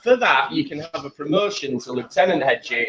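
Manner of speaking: usual speaking voice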